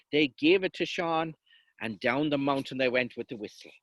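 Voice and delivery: ordinary delivery, nothing marked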